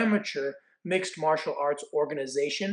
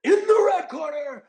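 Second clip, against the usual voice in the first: booming fight-announcer call, much higher and louder; Bruce Buffer; 10 semitones higher, 4 dB louder than usual. Retold or imitated. Announcing the fighter to the arena in booming Bruce Buffer style. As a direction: announce the red corner like a ring announcer. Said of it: "my best Bruce Buffer impression".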